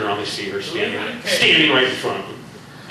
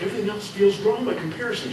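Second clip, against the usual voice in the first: quick, softer, teasing echo of his plea; mocking quote; 8 semitones higher, 4 dB quieter, faster than usual. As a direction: tease the line back lightly. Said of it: "'cause he's like".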